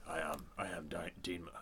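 low, soft mumbling imitation of Dean Malenko; Dean Malenko impression. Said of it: "he just talks like this".

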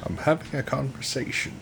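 a quiet, lightly voiced Morgan Freeman take. Friends riffing on the frogman's voice, hushed narrator impression. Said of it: "What if it was Morgan Freeman?".

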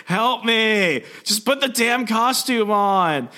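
high, strained cry for help; mock desperate plea. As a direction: imitate someone hollering while dragged away. Peter dragged off, yelling for help.

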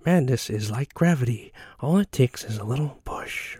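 softly voiced movie-villain impression; Heath Ledger. Quoting the film while recalling its release, light impression. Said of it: "You know, the Heath Ledger".

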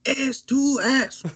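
high, slow, badly faked Japanese accent; a hammy, drawn-out attempt at a Japanese accent; bad Japanese impression. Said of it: "they're Japanese".

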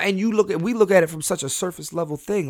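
normal talking voice

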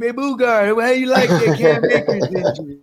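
put-on lightly done Louisiana accent. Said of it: "It was a horrible impression of him".

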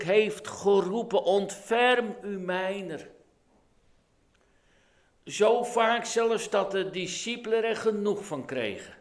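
hoog en luid oplopende preek; vurig preken. Acted. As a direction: preek met volle kracht en aandrang. Preek op volle kracht. (luide, hoge prediking)

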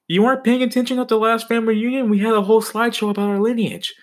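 high, fast put-on mom voice; mom's voice. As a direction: play the mom, scolding him a little. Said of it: "She was like".

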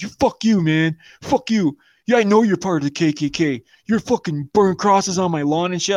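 imitated angry rant. Acting out the ranting friend.

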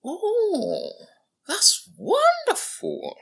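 very high, loud, slow delighted cry; old man gushing with joy. A narrator's reading. Old man delighted by the drunk's answer. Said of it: "the old man said with delight".

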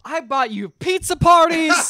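high, slow shouted whiny boss; mock boss shout. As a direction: yell it like an offended boss. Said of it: "a boss coming in being like".

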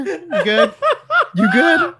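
high, laughing check-in; giggling you good; laughing tease. Real, not acted. Teasing a laughing friend.

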